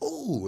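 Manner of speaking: high-pitched imitated "ooh"; imitated reaction. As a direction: let out a high "ooh", mimicking her. Imitates her reaction during sex.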